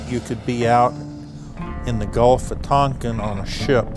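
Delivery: ordinary delivery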